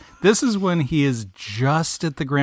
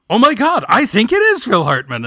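higher, louder amazed exclaim; excited realization; 8 semitones higher, 8 dB louder than usual. Retold or imitated. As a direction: blurt it out amazed. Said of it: "was like".

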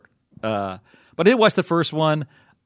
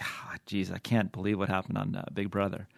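low, hushed mock dismay; feigned disbelief; 5 semitones lower, 10 dB quieter than usual. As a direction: drop it softly and low, as bait. Retold baiting of Manny at breakfast.

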